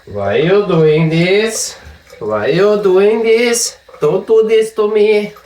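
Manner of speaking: rising falsetto whine of mock betrayal; mock plea. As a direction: beg like a wronged victim, hammed up. Pleading with his sore arm.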